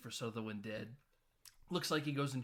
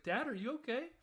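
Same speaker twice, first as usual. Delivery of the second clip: high-pitched worried daughter imitation; mock concern; 11 semitones higher, faster than usual. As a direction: imitate a daughter checking on her dad, pitched way up. Retold daughter worried about Grandpa.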